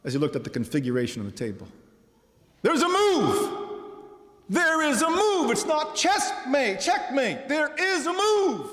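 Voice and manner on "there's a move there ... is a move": high, loud, triumphant repeated cry; excited shouting. Retold or imitated. Preacher voicing the chess fan seeing the hidden move, jubilant shout. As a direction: shout the discovery to the whole gallery. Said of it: "he shouted".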